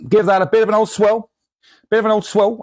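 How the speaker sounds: lightly put-on Cockney, pitched high; a hint of a Cockney market trader. Shop owner swirling the jar in a light Cockney. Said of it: "I've turned into a Cockney".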